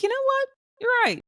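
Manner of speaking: very high-pitched, playful agreement; hammed-up sudden agreement; mock concession. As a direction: concede in a lilting, put-on high voice. Acts out own reply to boss.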